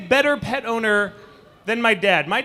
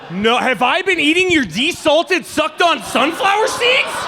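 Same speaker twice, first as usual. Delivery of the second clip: horrified shout; 4 semitones higher than usual. Retold or imitated. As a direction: shout it in disbelief at the son. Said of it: "I'm like".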